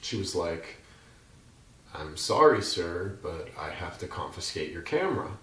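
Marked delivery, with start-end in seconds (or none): none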